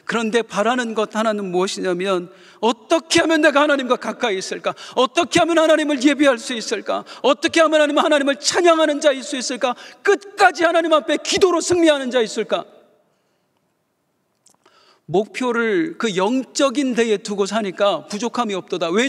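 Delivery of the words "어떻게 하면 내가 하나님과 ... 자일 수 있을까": each question higher and louder, preaching harder; building plea. Real, not acted. Preacher pleading to the congregation, rising louder and higher. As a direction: climb toward a fervent preaching cry.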